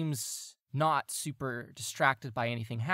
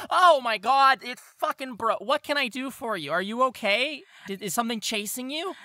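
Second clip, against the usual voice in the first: higher, louder, flustered guard blurting; startled guard; 10 semitones higher, 7 dB louder than usual. Acted. GM voicing Bert startled by the shouting player. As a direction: blurt it out, rattled and worried. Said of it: "and he'll go".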